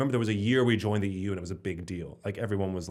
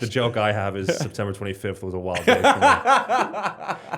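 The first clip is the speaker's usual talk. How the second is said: laughing through joke; 3 semitones higher, 8 dB louder, slower than usual. Real, not acted. Delivering his old joke.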